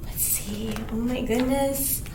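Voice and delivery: ordinary delivery, nothing marked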